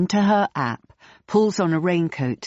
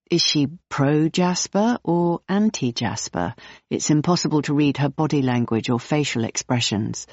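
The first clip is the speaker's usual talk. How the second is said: ordinary delivery, nothing marked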